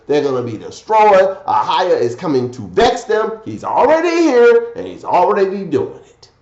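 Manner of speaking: emphatic sermon delivery, key words punched; forceful preaching. Real, not acted. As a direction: preach it loud and rising, scripture and commentary.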